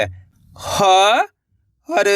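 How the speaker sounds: ह, हर as separate held sounds; syllable by syllable. Shown as a demonstration. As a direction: sound out ह, हर with a gap between. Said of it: "एक अक्षर का उच्चारण करना है". (ह, हर अलग-अलग, खींचकर)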